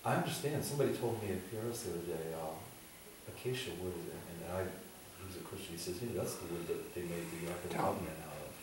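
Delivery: ordinary delivery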